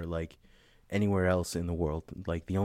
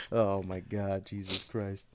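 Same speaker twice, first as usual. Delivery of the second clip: normal talking voice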